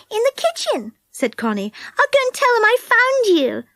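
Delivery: put-on Connie's bright girl voice. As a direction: speak up high like a keen little girl. Connie eager.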